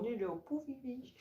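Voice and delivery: soft, high cutesy squeak; small, high, hushed cooing; cutesy little voice. Acted. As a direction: coo it softly, like something tiny. Cooing about a tiny fish.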